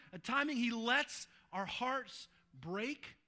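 loud, high, driving sermon voice; full-force preaching. Acted. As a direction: preach it out to the back row. Preaching climax.

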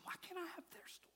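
hushed, longing whisper; whispered lament. Retold or imitated. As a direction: whisper it like a private prayer. Voicing a quiet envious prayer.

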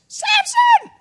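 very high, loud shout of his name; shouted warning. Acted. Delilah shouting to wake Samson, very high and loud.

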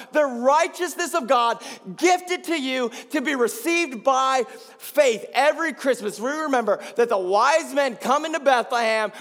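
high, loud impassioned preaching; preaching at full force. Real, not acted. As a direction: drive each phrase home to the congregation.

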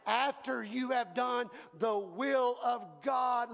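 "After" stressed, whole line slowed; slow emphatic repeat. Shown as a demonstration. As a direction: lead the congregation, slowly. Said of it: "everybody say after".

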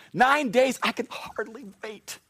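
high, giddy countdown; bursting with anticipation; animated. Acted. Jesus counting down the days to the angels, giddy and high. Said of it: "I'm getting animated up here".

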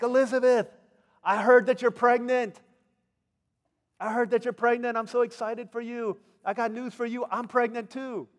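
high, loud excited greeting; gushing, high-pitched young woman sharing news; excited announcement. Acted. Acting Mary arriving with news.

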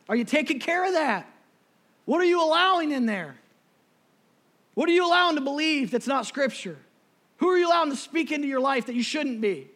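loud, high, driving sermon; full-force preaching. Real, not acted. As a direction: preach it hard at the congregation.

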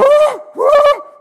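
high, loud gasping whoop; breathless cry of someone just off a ride; overwhelmed whoop. Acted. Believer greeting glory like a coaster rider, overwhelmed high whoop.

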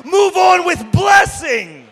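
slow, loud command from the pulpit; booming blessing; loud charge. Acted. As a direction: call it out big and drawn out.

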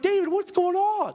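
high-pitched, bewildered protest; mock exasperation. Retells reacting to screaming child.

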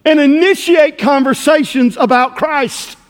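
impassioned pulpit appeal; forceful preaching. Real, not acted. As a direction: press the challenge hard, drawing out the last words.

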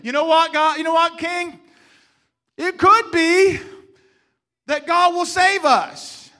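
loud and defiant, slowing right down on the last words; defiant declaration. Acted. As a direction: stand tall and tell the king off. Defiant answer to the king.

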